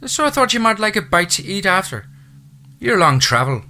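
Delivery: a chatty Irish housekeeper's brogue; thick Irish brogue. A narrator's reading. Irish housekeeper welcoming the new owner, thick Irish brogue. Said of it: "said in a thick Irish brogue".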